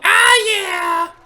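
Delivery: high loud sudden cheer; excited yell. Real, not acted. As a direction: whoop in triumph. Sudden cheer mid-sentence.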